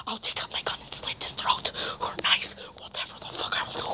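whispered, cold threat; menacing whisper. Acted. Threatening a companion who pointed a gun, in a menacing whisper. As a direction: hiss the threat under your breath.

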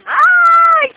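sudden high shriek of pain; pained yelp. Real, not acted. Owner shrieking when the parrot bites her.

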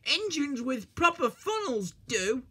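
high, cheeky little engine. A narrator's reading. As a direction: tease him with a cheeky grin. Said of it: "said Percy in his cheeky way".

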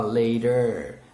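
American "later" with a tapped t; American pronunciation. Shown as a demonstration. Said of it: "o americano gosta mais de falar".

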